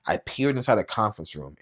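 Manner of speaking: ordinary delivery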